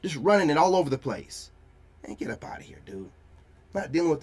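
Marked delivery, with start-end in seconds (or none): 2.1-3.1 a breathy whisper, brushing them off; whispered dismissal. Real, not acted. Waving off his online critics in a whisper. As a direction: whisper it like you're done with them.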